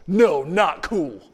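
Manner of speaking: loud, slow imitated disapproval; mock stern rebuff. Protester rejecting a friendly answer, in a slow, loud mock rebuke. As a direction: shoot the answer down loudly, drawing it out.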